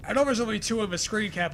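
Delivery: ordinary talk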